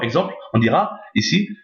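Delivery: ordinary talk, nothing marked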